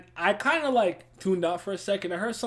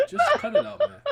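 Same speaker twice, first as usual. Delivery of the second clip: very high, laughing; laughing squeal. Retold or imitated. Laughing high retold plea.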